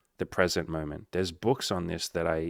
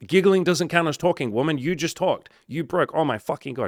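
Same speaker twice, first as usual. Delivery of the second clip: much higher and louder mock outrage; playful outraged accusation; 9 semitones higher, 8 dB louder than usual. To his date who talked first, loud playful outrage. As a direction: yell it like you caught her cheating at a game.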